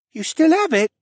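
put-on high, loud amazed boy voice; can't believe it; surprised boy. Boy amazed Alex kept the pendant, high and loud.

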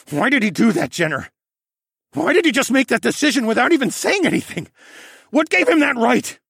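loud, high questions, building in force; anguished anger. A narrator's reading. Alex demanding answers from Jenner, angrily upset.

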